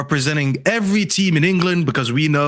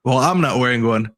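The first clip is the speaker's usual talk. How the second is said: ordinary talk, nothing marked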